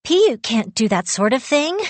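high, alarmed refusal; dismayed protest. A narrator's reading. Girl refusing to lose Yu, dismayed protest. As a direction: protest it, pitch leaping up in dismay. I felt like crying.

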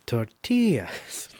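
tortillas with a soft mock Spanish accent, slow; playful Spanish pronunciation of tortillas. Shown as a demonstration. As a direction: say tortillas the Spanish way, rolled and drawn out. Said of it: "with accent".

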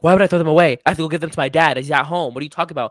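loud, high and frantic; a mother who won't let go of her dad's clothes; delirious mom. Retold or imitated. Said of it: "My mom was delirious".

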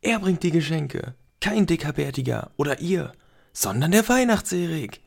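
höher und lauter, triumphierend rufen; aufgeregt rufen. Acted. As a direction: es allen beweisen wollen. Felix verkündet aufgeregt der Familie. (triumphierender, lauter Ruf an die Familie)

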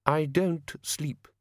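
high, slow and icy; cool, drawn-out rebuff; icily. A narrator's reading. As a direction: say it icily, each word spaced. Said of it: "said Frost icily".

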